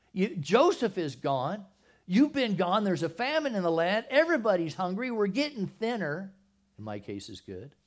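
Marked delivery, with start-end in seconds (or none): 0.1-6.3 grieving Jacob. Acted. Jacob reproaching his sons, loud and anguished. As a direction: plead like a father losing sons. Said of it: "their father, said to them".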